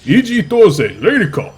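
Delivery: loud, high announcer shout with Raid turned into "Lady"; Korean announcer, "Lady Call". Retold or imitated. Caster correcting his imitation for his co-caster, Korean announcer shouting "Lady Call". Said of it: "he says Lady Call, actually".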